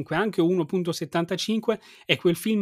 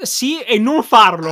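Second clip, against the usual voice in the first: laughing; 6 semitones higher, 11 dB louder, slower than usual. Real, not acted. Ridendo della ricerca su Google.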